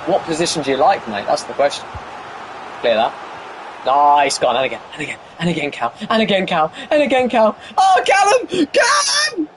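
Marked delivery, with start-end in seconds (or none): 4.4-9.5 rising calls breaking into a high anguished shout; building anguished yell. Real, not acted. Gamer watching Callum's run, rising to an anguished high shout. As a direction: cheer him on, then howl as it goes wrong. Teammate's chance, then a miss.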